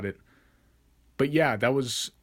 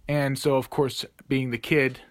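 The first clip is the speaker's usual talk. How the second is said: ordinary talk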